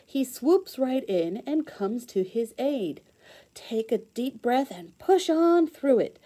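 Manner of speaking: lively, sing-song storybook read-aloud; reading to children. Acted. Teacher reading a rhyming book to kids, bright storybook voice.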